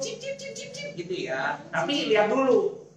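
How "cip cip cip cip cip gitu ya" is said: very high, soft chirping cip; bird chirps. Shown as a demonstration. Leader teaching the bird answer, high soft chirps. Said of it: "Kalau Papi bilang".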